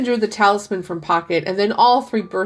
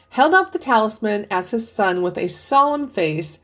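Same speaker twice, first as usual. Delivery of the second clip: plain reading voice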